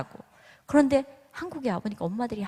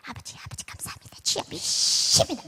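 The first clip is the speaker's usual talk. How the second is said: whispered fervent prayer; 4 semitones higher than usual. Retold or imitated. A praying mother whispers to God on her knees, hushed and fervent. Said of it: "막 이러면서 기도하는데".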